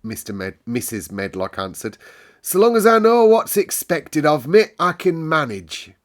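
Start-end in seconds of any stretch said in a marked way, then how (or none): 2.4-5.9 much higher and louder, prim and proper; a bossy housekeeper answering sharply; stiff housekeeper. A narrator's reading. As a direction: housekeeper to the butler, loud and prim. Mrs Medlock, brisk.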